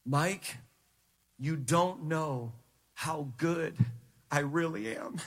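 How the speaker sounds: low, soft, slow and measured, with weighty pauses; hushed, low divine authority; sovereign voice of God. Retold or imitated. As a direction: quietly speak as God, unhurried and deep. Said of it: "I heard the sovereign voice of God say this".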